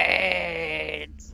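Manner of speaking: moaning zombie voice. Pretend. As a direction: groan it like a hungry zombie. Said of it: "Give me a monster impression".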